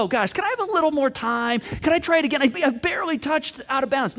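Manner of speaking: pleading player. Acted. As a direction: protest with a touch of whining appeal.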